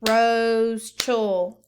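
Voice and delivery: "Rose chul" loud, one beat per syllable; clapped syllables. Shown as a demonstration. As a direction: clap it out loudly for the class. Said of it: "Clap it".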